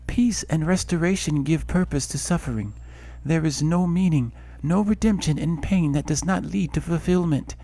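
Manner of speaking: a goddess warning of tragedy; solemn goddess voice. Acted. Goddess voice continues.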